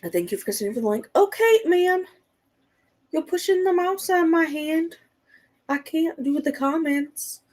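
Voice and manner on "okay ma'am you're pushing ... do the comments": high pet-talk scolding; high-pitched pet voice; talking to the cat. Real, not acted. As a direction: coo a mock telling-off to the cat, much higher.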